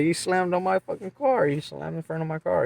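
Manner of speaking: high-pitched retold grievance; indignant complaint. Driver tells store guy about the car, indignant.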